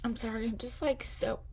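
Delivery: choking up, voice catching on the words; fighting back real tears; real emotion breaking through quiet halting speech; tearful. Real, not acted. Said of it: "I don't know why I'm getting so emotional right now".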